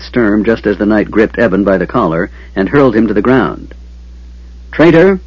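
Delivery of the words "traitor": high, loud accusing yell. A narrator's reading. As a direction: yell it in his face. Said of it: "Sturm yelled loudly".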